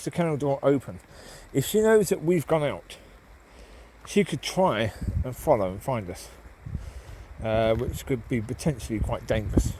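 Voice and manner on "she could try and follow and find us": usual speaking voice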